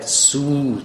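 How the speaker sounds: سو as "suu", a drawn-out oo; long "soo" for سو; long vowel. Shown as a demonstration. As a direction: stretch سو on a long u. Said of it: "محل اجرای بلند باشه".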